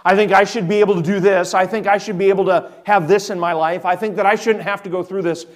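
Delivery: self-assured believer. Retold or imitated. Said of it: "We think we know what's better".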